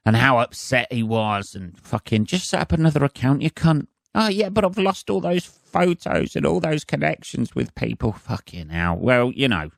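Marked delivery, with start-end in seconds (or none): 2.3-3.9 higher, fast and dismissive; scornful retort. Retold or imitated. To the Facebook victim, a fast scornful snap. Scoffing reply to whining user. 4.1-8.1 much higher, put-on whinge; mock whiny victim. Imitating the upset guy, a high mock whine.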